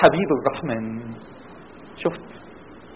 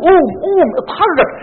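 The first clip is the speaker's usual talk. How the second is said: high, loud urging; urgent rousing command; 10 semitones higher, 4 dB louder, slower than usual. Acted. Preacher rousing listeners with the Quran's command, urgent. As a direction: rouse them to get up and move.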